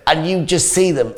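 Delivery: ordinary delivery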